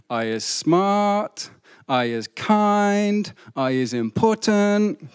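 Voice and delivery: slow Southern Mississippi drawl; Mississippi accent. Acted. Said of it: "that's my Mississippi accent".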